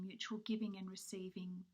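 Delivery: ordinary talk, nothing marked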